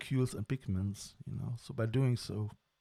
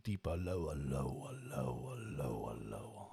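deeper, lower voice; 9 semitones lower, 11 dB quieter than usual. Shown as a demonstration. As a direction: voice sinking lower and lower. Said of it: "bring my voice into a storyteller voice".

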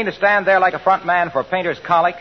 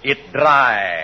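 hammy stage actor; 7 semitones lower than usual. Acted. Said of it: "Go on, [name]".

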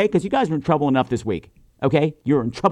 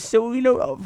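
ordinary talk

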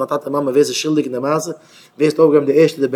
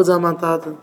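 ordinary talk

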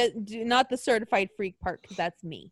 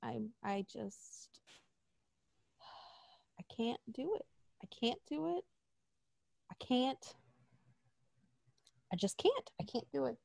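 much softer, defeated; mock despair; 3 semitones lower, 18 dB quieter than usual. A wrestling fan giving up on the storyline, quietly defeated. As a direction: let the words sink out of you.

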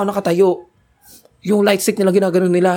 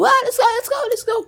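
imitated shouting fan.

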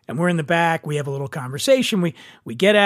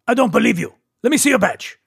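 higher-pitched imitation of a Persian driver; Persian accent; 5 semitones higher, faster than usual. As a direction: demand it in a mock Persian accent. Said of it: "I'm doing the accent".

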